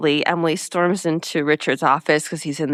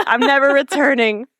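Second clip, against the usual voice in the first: mock dramatic vow; 7 semitones higher, 5 dB louder than usual.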